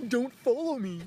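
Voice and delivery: high-pitched mocking imitation of her; put-on high voice for a retold rebuke; mimicked woman. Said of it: "She's like".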